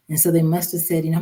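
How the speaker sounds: normal talking voice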